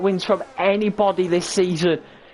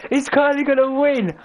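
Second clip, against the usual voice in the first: excited; 6 semitones higher than usual. Real, not acted. Climax of race commentary.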